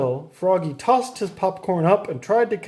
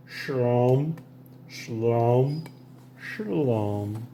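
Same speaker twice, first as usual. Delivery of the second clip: dejected; 3 semitones lower, 5 dB quieter, much slower than usual. A narrator's reading. Froggy drags himself home.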